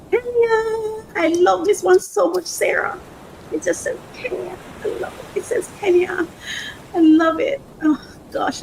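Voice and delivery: high-pitched, tearful delight; overcome with emotion. Real, not acted. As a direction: gush with love, voice close to crying. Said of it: "I'm feeling so emotional".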